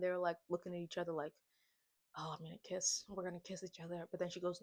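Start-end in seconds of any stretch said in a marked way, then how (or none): 2.2-4.1 hushed. Retold or imitated. Tense almost-kiss moment.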